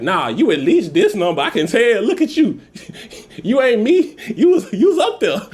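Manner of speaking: high-pitched mocking kid's retort; teasing youngster. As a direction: tease like a cheeky youngster, pitch climbing. Voices youngster guessing his age.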